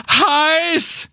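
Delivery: high squeal of pain from hot sand; high yelp. Acted. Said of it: "stellen wir mal so eine typische Geräuschkulisse nach".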